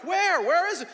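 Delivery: high, loud, urgent acted questions; panicked. Man down the hill calling up to his family, acted panic. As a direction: call out in a mock panic. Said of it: "like, panicking".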